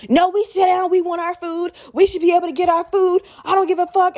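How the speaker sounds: high, insistent retold yelling; mock screaming. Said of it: "Now she's screaming at me".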